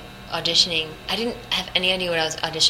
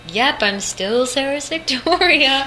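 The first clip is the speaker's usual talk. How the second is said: high, slow, mock-proud chirp; playful high drawn-out boast; cheerful; 8 semitones higher, 5 dB louder, much slower than usual. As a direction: sing it out like a proud old hand. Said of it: "I'd be like".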